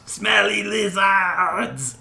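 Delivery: loud, high, drawn-out shout; battle cry. Acted. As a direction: holler it slowly as a taunt at the enemy. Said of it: "And Pungent Sky goes".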